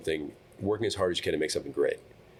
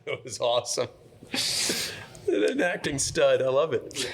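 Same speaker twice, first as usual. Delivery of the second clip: gushing praise; 7 semitones higher than usual. Pretend. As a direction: rave like an awestruck fan. Gushing praise after the scene.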